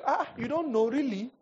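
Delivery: high, loud sarcastic disbelief; really, you don't know; incredulous mocking. Real, not acted. Pastor mocking the claim.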